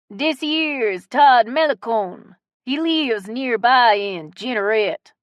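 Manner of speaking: loud, very slow Cajun drawl; strong Cajun accent. A narrator's reading. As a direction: stretch out the introduction in broad Cajun. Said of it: "in his strong Cajun accent".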